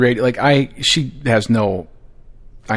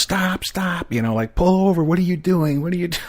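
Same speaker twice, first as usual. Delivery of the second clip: frantic yelling; 7 semitones higher than usual. Retold or imitated. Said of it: "waving my arms".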